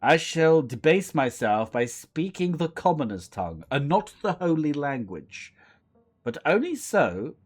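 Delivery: loud, disdainful cat aristocrat; haughty cat. Acted. Cat condescends to speak the commoner's tongue, haughty. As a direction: look down on the commoner, grandly and loudly.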